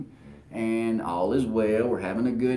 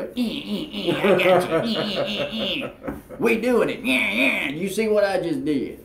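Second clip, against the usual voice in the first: gloating trash talk; 7 semitones higher than usual. Retold or imitated. Mother-in-law taunting her son-in-law over the score, gloating jeer. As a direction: jeer like a rival fan who just scored. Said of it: "the nicest woman ... into a".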